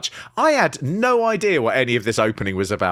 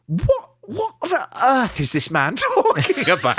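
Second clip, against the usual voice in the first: bewildered; 8 semitones higher than usual. Retold or imitated. Baffled reaction voiced.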